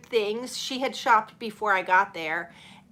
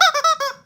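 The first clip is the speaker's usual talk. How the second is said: short high burst of laughter; 6 dB louder than usual. Real, not acted. Host laughing at Lola not moving, high burst. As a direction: laugh up high, loud and quick. Laughing at her cat on stream.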